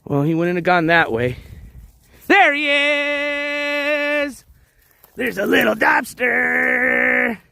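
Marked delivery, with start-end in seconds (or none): 2.3-7.4 relieved excitement. Real, not acted. Spots lost puppy on beach.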